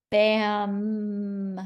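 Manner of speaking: "Bam" with the final M hummed long; drawn-out M. Shown as a demonstration. Teacher sounding out bamboo, long humming M in "Bam". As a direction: stretch the last sound of "Bam" into mmm. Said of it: "What letter makes the M sound?".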